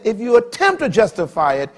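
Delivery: high, loud remembering cry; preaching. Acted. Preacher telling the church he still hears the chaplain, loud. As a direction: lift it like a testimony.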